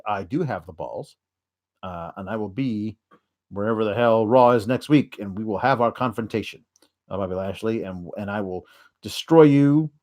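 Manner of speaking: lightly voiced grim Rusev; mock Rusev. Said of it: "Rusev is like".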